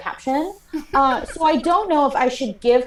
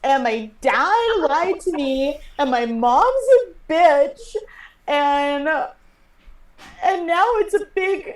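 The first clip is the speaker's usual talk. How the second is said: upset meltdown; 8 semitones higher, 4 dB louder than usual. Acted. Young passenger lists her woes to older men, wailing meltdown. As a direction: stretch each complaint out, pitched way up.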